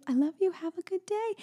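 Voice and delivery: high, sing-song mom farewell; sweet goodbye. Retold or imitated. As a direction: send her off lovingly. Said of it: "and I say".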